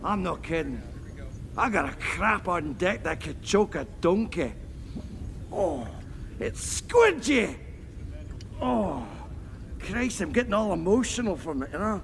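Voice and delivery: broad Scottish brogue, crude and cheerful; thick Scottish accent, pleased with himself; Fat Bastard's Scottish. Acted. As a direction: play it as a coarse Scottish lout. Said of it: "Fat bastard".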